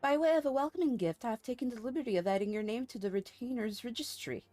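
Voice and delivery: stately in-game dialogue read-aloud; formal game character. Acted. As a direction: read it like a courteous order leader.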